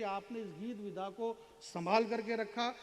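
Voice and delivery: ordinary talk, nothing marked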